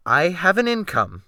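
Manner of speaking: stout answer. A narrator's reading. Gentleman answering the captain, stoutly. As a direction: stand your ground with it. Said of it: "I answered stoutly".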